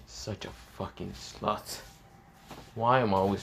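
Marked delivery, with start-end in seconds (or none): 0.1-1.8 much softer, slow contemptuous mutter; muttered contempt. Acted. Issei curses the girl in his head, soft and slow. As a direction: spit it under the breath.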